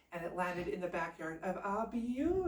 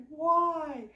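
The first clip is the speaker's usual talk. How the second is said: high loud wail of why; dismayed why; 9 semitones higher, 8 dB louder than usual. Acted. Youngest son reacts to the beauty contest, high dismayed wail. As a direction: squawk it in dismay.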